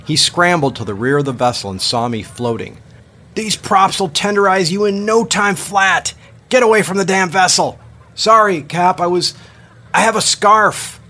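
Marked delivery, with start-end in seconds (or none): 3.3-7.8 loud, high furious captain's bark; angry yell. Acted. Captain yelling at swimmer. 8.1-11.0 loud, high flustered shout. Acted. Shouting apology to captain.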